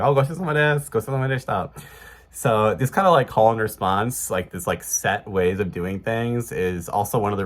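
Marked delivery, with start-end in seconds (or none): 0.0-1.7 mild mock shout to staff. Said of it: "shout to the staff".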